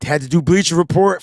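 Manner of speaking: normal talking voice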